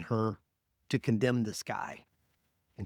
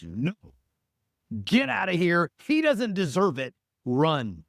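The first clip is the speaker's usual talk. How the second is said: sharp, higher, louder alarm; the voice of fear, higher and louder, telling her to run; urgent inner voice; 6 semitones higher, 6 dB louder than usual. Retold or imitated. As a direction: shout the urge to flee. Inner voice urging her to flee.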